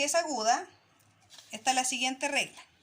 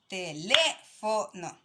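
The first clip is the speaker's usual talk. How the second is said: "Teléfono" said slowly, LÉ in "teléfono" leaned on; syllable stress on LÉ; 5 semitones lower, much slower than usual. Shown as a demonstration. Teacher to pupils, showing stress on LÉ in "teléfono". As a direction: drag out "Teléfono", weight on LÉ. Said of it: "donde se marca la voz es en la tercera".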